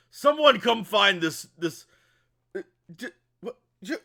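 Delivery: high, loud, spluttering exasperation; over-the-top fluster. Acted. Grant faced with a pot-lid fighter, over-the-top flustered. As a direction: splutter helplessly, then burst into a high yell. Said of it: "he's, like, very, like, extra about it".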